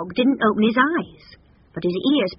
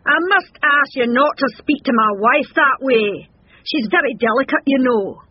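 higher, louder blustering husband; pompous, protesting bull; protective bull; 6 semitones higher, 5 dB louder than usual. A narrator's reading. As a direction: stand up for your delicate wife. Bull defends his offended wife.

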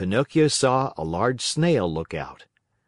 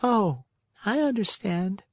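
snail voice; 10 semitones higher than usual. Acted. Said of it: "said the snail".

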